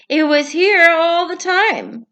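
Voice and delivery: surprised Toad. Acted. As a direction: realise it with amazement. Toad amazed.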